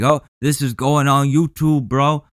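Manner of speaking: a light mocking voice; mock filming taunt. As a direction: sneer like a smug guy filming for YouTube. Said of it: "I wouldn't be like".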